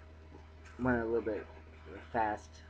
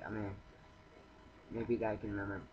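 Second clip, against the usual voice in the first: usual speaking voice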